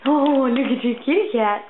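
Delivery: normal talking voice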